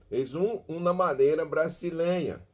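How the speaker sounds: ordinary talk, nothing marked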